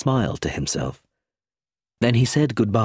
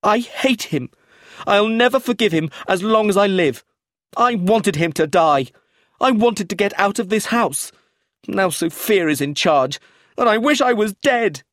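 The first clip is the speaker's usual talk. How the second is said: very high, furious tantrum; high and angry; 12 semitones higher, 5 dB louder than usual. A narrator's reading. Eustace disinherited and hating his grandfather, high and angry. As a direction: rage at the top of your voice. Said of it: "his voice high and angry".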